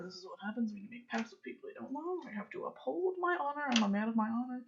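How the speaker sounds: soft, hurried self-pep-talk; muttering under breath. Acted. Character walking off talking to himself, muttered. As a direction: grumble it quickly to yourself. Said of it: "muttering this under your breath".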